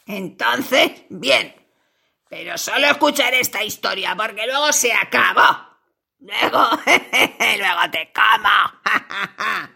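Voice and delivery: low spider voice with cackling laughs; gloating spider. Acted. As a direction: laugh cruelly at the trapped fly. Spider agrees, threatens.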